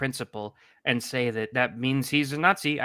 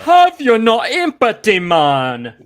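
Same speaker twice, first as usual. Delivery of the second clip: much higher and louder, hammed-up indignation; mock outraged plea; 9 semitones higher, 13 dB louder, slower than usual. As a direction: plead loudly in fake shock.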